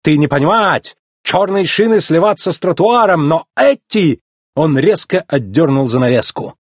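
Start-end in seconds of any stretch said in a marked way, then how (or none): 0.0-4.2 high, excitable foreigner; theatrical shopkeeper's protest; indignant Italian. A narrator's reading. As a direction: insist passionately to a customer who misses the point. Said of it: "возмутился Луиджи".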